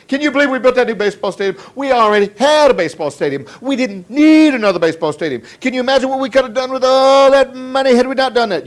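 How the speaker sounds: high, loud mock griping; whining complainer. Stranger complaining about wasted stadium money, loud mock whine. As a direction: imitate an indignant whiner, hammering it out. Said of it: "and they're whining".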